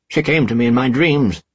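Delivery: high, loud cry out of nowhere; sudden shout. A narrator's reading. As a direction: blurt it out wildly. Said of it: "the old man shouted suddenly".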